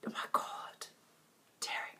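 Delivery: breathless whisper to herself; laughing whisper; whispered aside. Real, not acted. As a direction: whisper it in disbelief. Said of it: "I'm still going on about dairy".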